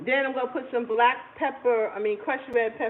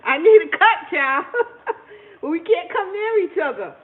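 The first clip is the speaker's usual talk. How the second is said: laughing plea; 5 semitones higher, 8 dB louder than usual. Real, not acted. Laughing about her hair.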